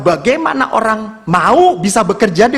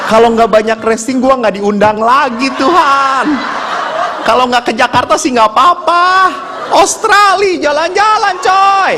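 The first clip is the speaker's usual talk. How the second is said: rising, ever higher bargaining with God; comic pleading; 5 semitones higher than usual. Retold or imitated. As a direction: build to a high, excited mock wail. (rayuan doa yang makin heboh)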